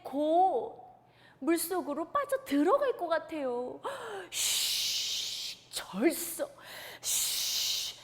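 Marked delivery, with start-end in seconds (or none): 4.3-5.7 breathy whoosh of a storm wind. Acted. Storyteller making the wind whoosh. 7.0-8.0 whooshing wind. Acted. Wind sound effect in story.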